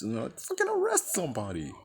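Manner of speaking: usual speaking voice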